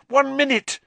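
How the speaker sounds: very high, loud alarm; panicked warning. A narrator's reading. Hermione checks her watch, panicking. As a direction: cry out the countdown urgently.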